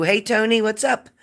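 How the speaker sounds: high, bright hello called out; excited greeting. Real, not acted. Host greeting a newcomer, high and excited. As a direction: call out to a friend arriving.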